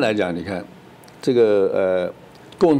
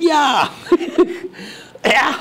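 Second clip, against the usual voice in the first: much higher squeal with a giggle, caught out; mock flustered consul; slower than usual. Consul caught pulling hair, mock high flustered squeal. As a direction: squeak and laugh like you've been caught. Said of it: "说".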